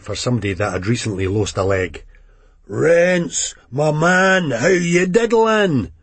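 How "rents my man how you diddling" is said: high, loud, slow and cheerful; exuberant junkie. A narrator's reading. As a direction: greet him with big bravado. Johnny voiced in the story.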